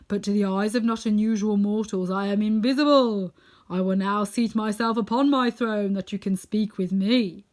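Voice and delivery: solemn, loud pronouncement; booming, stately voice of an unseen wizard. A narrator's reading. Unseen wizard explains himself to visitors, solemn and loud. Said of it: "they heard a solemn voice".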